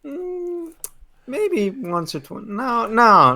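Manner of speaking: high-pitched, wavering self-doubt; unconvinced. Acted. Player character asked about lost control, unconvincingly denying it. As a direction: hum high, then deny it lightly.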